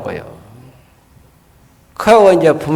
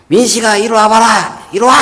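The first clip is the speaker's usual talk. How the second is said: a mother's raised call to her son; 6 semitones higher than usual. Retold or imitated. Mother summoning Minsik to wipe his nose, calling high. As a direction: call the boy over, pitched up. Mother calls child over.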